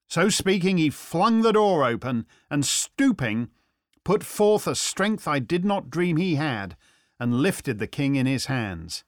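usual speaking voice

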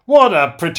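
loud Yorkshire scoff, cut off; Parky's Yorkshire accent. Retold or imitated. Talk show host scoffs at the review, loud in Yorkshire. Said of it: "in his Yorkshire accent".

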